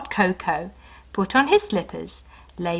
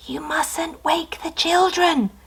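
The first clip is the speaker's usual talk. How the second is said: higher, slow whispered warning; 8 semitones higher, slower than usual. Acted. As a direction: warn him without voice. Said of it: "she whispered back".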